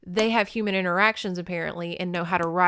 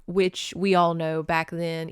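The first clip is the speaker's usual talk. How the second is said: ordinary delivery, nothing marked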